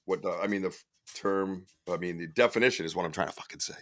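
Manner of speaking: fast, breathless whispered grumble; whispered aside. Real, not acted. Host mutters to himself over a word. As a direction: rush it out under your breath.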